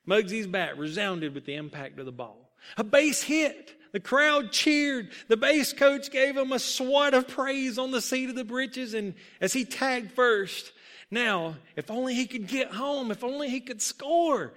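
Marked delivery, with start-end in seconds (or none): 2.8-14.5 raised, pressing storyteller's excitement; excited play narration. A narrator's reading. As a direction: tell it high and thrilled, like the hit just happened. Narrating a hit, crowd cheering.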